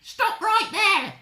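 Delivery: very high, loud, slow command; Granny's yell. Acted. Granny halting the wolf, high loud yell. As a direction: yell it as a fierce old Granny. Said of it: "yelled Granny".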